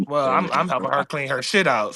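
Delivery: ordinary delivery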